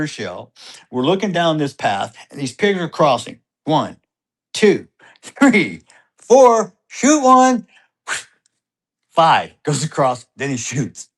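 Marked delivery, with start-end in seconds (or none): 3.6-7.7 rising higher and louder with each number; excited counting. Retold or imitated. Dad counting pigs crossing for his son, building excitement. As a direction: count them off faster and more urgent.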